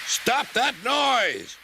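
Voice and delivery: furious boss bellowing. Acted. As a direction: roar it out to stop the racket. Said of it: "bellowed Sir Topham Hatt".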